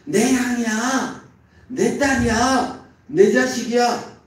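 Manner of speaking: a preacher voicing Laban's possessive boast; Laban claiming ownership. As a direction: drag out each claim like a greedy uncle. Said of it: "라반과 똑같아요".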